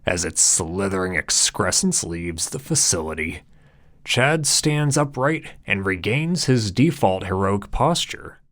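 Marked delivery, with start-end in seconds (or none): none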